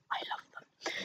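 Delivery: a fond, breathless whisper; whispered confession of love. Real, not acted. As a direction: tell it like a little secret. Secret delight over proof copies.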